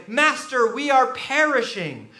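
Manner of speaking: urgent plea. Retold or imitated. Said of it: "Luke says that they say".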